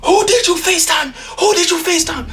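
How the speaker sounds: very high shouted interrogation; principal demanding. Retold or imitated. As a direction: hammer the question at him.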